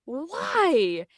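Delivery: a half-real wail of why at the closed door; shout to the heavens. Retold or imitated. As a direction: cry out to the sky in frustration. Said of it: "I wanted to shout to the heavens".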